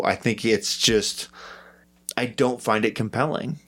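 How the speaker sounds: normal talking voice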